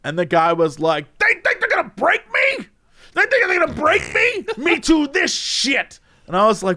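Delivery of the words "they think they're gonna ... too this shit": very high-pitched mock outrage; hammed-up ranting, pitch shooting way up; imitated furious rant. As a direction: screech it like an indignant man losing it. Acting out the furious man's rant.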